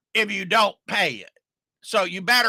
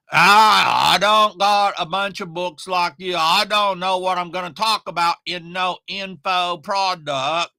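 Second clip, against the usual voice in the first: lightly put-on Oklahoma drawl, a touch louder at the start; a hint of a country Oklahoma customer; 4 dB louder than usual. Mocking a doubtful Oklahoma customer, light country drawl. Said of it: "I like to do that voice".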